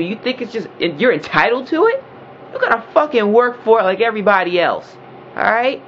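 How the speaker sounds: high, heated tirade; real outburst, voice up high; angry rant. Real, not acted. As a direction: let it all out, pitch climbing. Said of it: "I don't mean to get loud".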